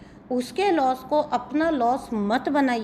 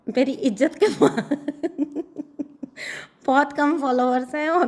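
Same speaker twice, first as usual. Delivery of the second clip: words dissolving into helpless laughter; cracking up; 4 semitones higher, 3 dB louder than usual. Real, not acted. Reader lamenting her few followers, cracking up mid-sentence. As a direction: start the complaint and collapse laughing. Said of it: "मुझे इतनी हंसी आ रही है".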